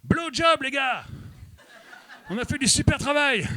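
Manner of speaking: high, cheery call to the team; mock office cheer. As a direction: shout it up high like a proud boss. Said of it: "ça vous évitera de dire". (collègue enthousiaste, voix haute et joyeuse)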